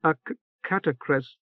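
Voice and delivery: teeth chattering with cold. Acted. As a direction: stutter the word through shivering. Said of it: "through chattering teeth".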